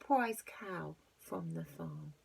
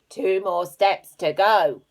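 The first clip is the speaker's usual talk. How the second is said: loud slow robber voice; 14 dB louder, slower than usual. Acted. Robber speaks.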